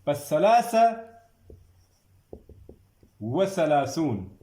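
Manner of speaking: teacher calling the next number; called out number. Shown as a demonstration. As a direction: announce the number, then dictate slowly. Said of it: "تکرار کرنے ہیں".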